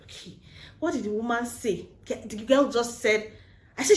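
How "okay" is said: very soft, sighed concession; acting a man quietly backing off; mock resigned. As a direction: let it drop out almost inaudibly. Guy gives up after being rebuffed.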